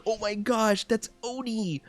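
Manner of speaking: high squealing fan voice; imitating an excited fan, high and giddy; mock excited fan. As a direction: gush like a starstruck fan. Mocking excited fans.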